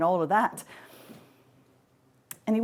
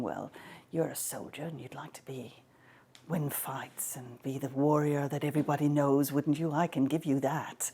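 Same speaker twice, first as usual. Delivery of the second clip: soft coaxing; 5 semitones lower, 5 dB quieter than usual. Acted. Athena's mind-voice bribe.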